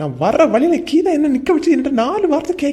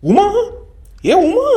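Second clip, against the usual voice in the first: raised call from the doorway; husband bursts in calling for his wife; 8 semitones higher, slower than usual. Acted.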